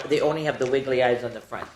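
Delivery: ordinary delivery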